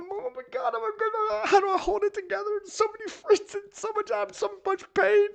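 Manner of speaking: very high, hammed-up mock wailing; mock breakdown. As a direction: parody a theatrical breakdown. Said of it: "emotionally breaking down".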